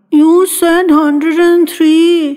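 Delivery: put-on high, slow, whimpering little girl's voice; frightened feverish girl whimpering at her mother; whimpering child. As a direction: drag it out like a child about to cry. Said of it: "she whimpered".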